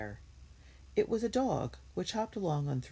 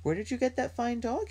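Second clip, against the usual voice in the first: high-pitched, impressed exclamation; surprised cry; 10 semitones higher than usual. A narrator's reading. Henry spots the children's new dog, crying out in surprise. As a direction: ask it high in happy surprise. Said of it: "he cried".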